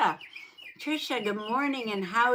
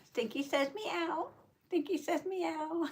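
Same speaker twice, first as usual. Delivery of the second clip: higher, softer voice for the cat; mock cat voice; 6 semitones higher, 4 dB quieter than usual. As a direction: speak for the kitty, higher and softer. Cat voice for Stinky.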